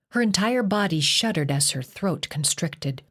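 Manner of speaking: usual speaking voice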